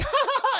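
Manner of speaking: high-pitched imitated ha-ha-ha; mock laughter. Streamer imitates Anakin once she turns him over, mock laughter. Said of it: "and they're laughing".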